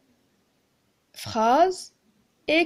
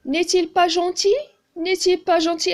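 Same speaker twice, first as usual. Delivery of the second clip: "N'est-il pas gentil?" read higher and louder, rising on "gentil"; question tune; 5 semitones higher, 4 dB louder than usual. Shown as a demonstration. Teacher reading a question to pupils, higher with a rising end. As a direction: lift "gentil" into a clear questioning rise. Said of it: "كنشوف point d'interrogation".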